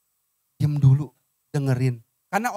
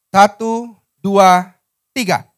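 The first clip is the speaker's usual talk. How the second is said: calling out a count-in; 3 semitones higher, 9 dB louder, much slower than usual. Real, not acted. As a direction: count them in, big and loud. Leads congregation into reading aloud. (hitungan aba-aba lantang)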